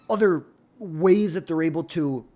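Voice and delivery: usual speaking voice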